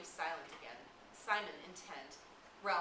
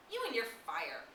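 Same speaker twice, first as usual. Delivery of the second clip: scornful retort; 6 semitones higher, 6 dB louder than usual. Acted. Jack scoffs at Ralph.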